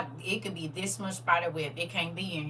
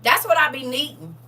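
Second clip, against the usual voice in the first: much higher, loud heartfelt burst; excited thanks; 9 semitones higher, 9 dB louder than usual. Real, not acted. Thanking viewers for letting her be human, loud and high. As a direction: cry out thanks with full feeling.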